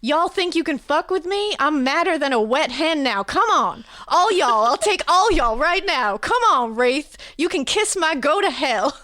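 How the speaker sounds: loud, high-pitched Southern rant; furious Southern yell, daring all comers; yelling southern twang. Acted. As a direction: holler a challenge in a thick southern twang. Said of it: "we're yelling in, in southern twangs".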